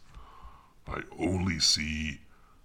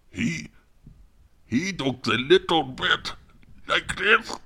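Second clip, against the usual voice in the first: high-pitched put-on Indian accent; 11 semitones higher than usual. Shown as a demonstration. Narrator demonstrates an audiobook character to the listener, high Indian accent. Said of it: "there's an Indian man".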